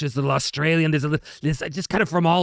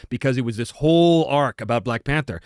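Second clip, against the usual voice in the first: ordinary delivery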